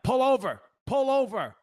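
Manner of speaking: high, mock police megaphone call; cop over the loudspeaker. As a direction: bark it like a cop through a car speaker. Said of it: "he speaks through the speakers".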